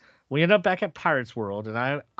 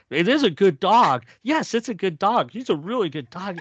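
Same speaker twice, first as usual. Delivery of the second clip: cooing over a dog; 8 semitones higher, 5 dB louder than usual. Retold or imitated. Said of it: "they're sitting there going".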